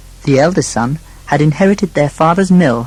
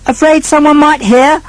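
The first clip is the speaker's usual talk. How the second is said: high, raised taunting question; loud scoff; 13 semitones higher, 5 dB louder than usual. A narrator's reading. Second son mocking the Marquis, loud scoff. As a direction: taunt him at top volume. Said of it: "in an even louder voice".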